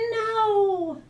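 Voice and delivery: loud high "No!" in unison; a high shouted no; emphatic shout. A narrator's reading. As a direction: yell it flat out together. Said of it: "they both shouted an emphatic".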